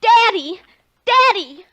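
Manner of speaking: loud, high desperate shout; shouting for dad. Acted. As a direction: call him back at full voice. Girl shouts for her father.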